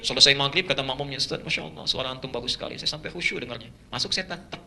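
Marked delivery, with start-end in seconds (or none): 1.2-3.7 soft, hushed praise; lightly voiced admiring congregant. Congregant quietly praises the imam's voice. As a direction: gush quietly.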